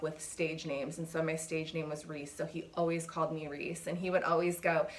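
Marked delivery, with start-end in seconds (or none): none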